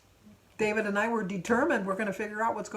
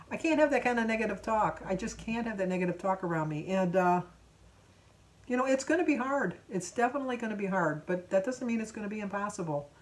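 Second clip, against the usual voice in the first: normal talking voice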